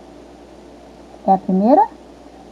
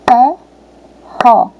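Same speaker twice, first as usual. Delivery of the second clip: "Ferro" split in two firm, loud beats; separação silábica; 5 dB louder than usual. Shown as a demonstration. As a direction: pause clearly between the syllables of "Ferro". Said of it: "nós vamos repetir". ("Ferro" em duas sílabas fortes e separadas)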